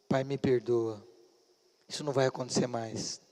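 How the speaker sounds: low, soft plea for forgiveness; retold apology. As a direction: quietly imitate a contrite son. Filho pede perdão ao pai. (pedido de perdão, baixinho)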